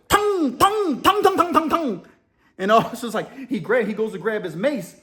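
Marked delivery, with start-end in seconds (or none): 0.1-2.1 very high, ringing nonsense syllables; mock foreign gibberish. Inmate staring at confused officer, very high mock gibberish. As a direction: chant pretend words high and insistent. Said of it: "looks at the officer and he goes".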